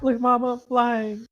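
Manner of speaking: put-on high, slow, delighted child calling mom; excited child voice. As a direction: call out like a thrilled little kid. Child character calling to mother.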